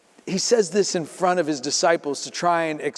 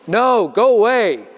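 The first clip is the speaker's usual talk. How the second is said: slow, louder, put-on voice from behind the door; gruff refusal; 5 semitones higher, 6 dB louder than usual. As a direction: shoo her off from inside.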